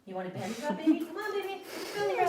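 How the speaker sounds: high cooing pet talk; talking to a baby. Acted. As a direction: sweet-talk a shy dog. Coaxing dog with belly rub.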